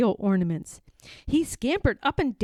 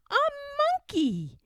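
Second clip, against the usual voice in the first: usual speaking voice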